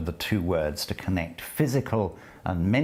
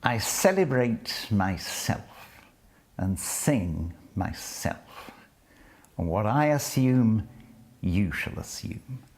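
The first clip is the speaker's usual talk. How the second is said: poetry recitation; 3 semitones higher than usual. Acted. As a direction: savour each line as you read it aloud. Lecturer reads the poem.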